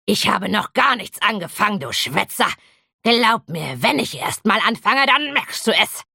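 a hint of a snarling troll, rising as he threatens; lightly voiced troll spitting back a threat; troll snapping back at the dragon; troll hissing back. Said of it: "fauchte der Troll zurück".

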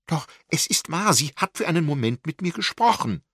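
high, loud, urgent insistence; excited call. A narrator's reading. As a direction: burst out, desperate to be believed. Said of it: "rief sie aufgeregt".

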